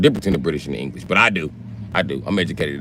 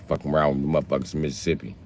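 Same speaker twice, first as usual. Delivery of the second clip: ordinary talk, nothing marked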